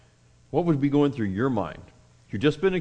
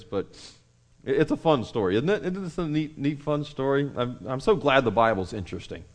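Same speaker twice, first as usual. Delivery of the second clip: ordinary delivery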